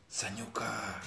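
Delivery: breathy unvoiced whisper. Real, not acted. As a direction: whisper the word softly. Quiet whispered word in monologue.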